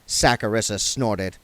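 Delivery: ordinary delivery, nothing marked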